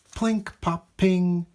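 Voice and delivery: high popping plink pop ping; robot noises. A narrator's reading. Narrator voicing robot parts flying off, playful. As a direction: pop each sound out.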